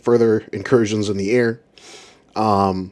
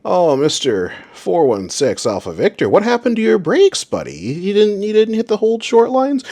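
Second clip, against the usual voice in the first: mock scolding; 10 semitones higher than usual. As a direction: needle him like a naughty kid. Mocking controller scolding pilot.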